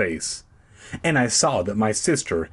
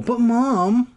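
protesting; 12 semitones higher, 4 dB louder than usual. A narrator's reading. Boy protests to mother.

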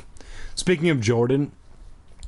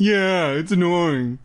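slow, higher mocking echo; obnoxious pitched-up parroting; annoying mimicry; 4 semitones higher, slower than usual. Shown as a demonstration. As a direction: drag it out like a bratty copycat. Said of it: "repeating things that people say in an annoying tone".

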